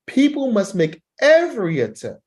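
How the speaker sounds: "every" punched high and loud; emphatic rise. Shown as a demonstration. Teacher shows how to stress "every" to make a point. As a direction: lift the whole line high and hit "every" hard. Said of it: "watch this last sentence".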